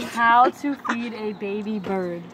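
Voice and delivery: slow search read-out. Real, not acted. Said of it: "reading a search aloud".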